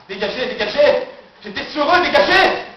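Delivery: rising, high shout; mounting panicked command; driving demons off. Acted. Drives off his inner demons.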